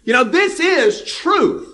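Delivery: high, insistent son of thunder; fiery disciple insisting this is the truth; intense indignation. Retold or imitated. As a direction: press it hard, incredulous. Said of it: "is kind of what he's saying".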